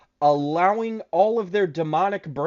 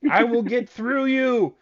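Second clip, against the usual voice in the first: hammed-up djinn, climbing higher and higher; protesting djinn; 4 semitones higher, slower than usual. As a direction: draw out the words in a mock-dramatic genie voice. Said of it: "the Djinn starts walking away backwards like".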